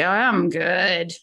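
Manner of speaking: "Oh, I'm good" said low and drawn out, with a falling tune; low, slow tone. Shown as a demonstration. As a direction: let "Oh, I'm good" sink down slowly. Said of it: "the difference between them".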